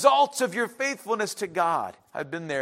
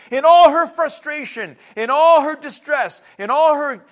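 loud preaching; 5 semitones higher, 8 dB louder than usual. Real, not acted. Preacher building intensity.

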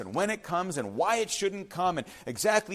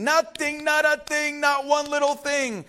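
higher, louder, punching each nothing; emphatic; 7 semitones higher, 3 dB louder than usual. Real, not acted. Preacher stressing the verse to congregation, emphatic. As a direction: drive home not one thing.

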